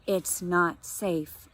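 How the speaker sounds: very slow, weighted repeat; slow repeated warning. Acted. Grandma Jeannie repeats her warning to Mom, very slowly. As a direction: lean hard on not, slowly.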